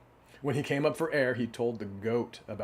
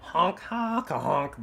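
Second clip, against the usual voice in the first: high honking goose laugh; 6 semitones higher, 4 dB louder, much slower than usual. A narrator's reading. Goose laughing at the cow, honking. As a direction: honk as if laughing. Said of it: "the goose laughed".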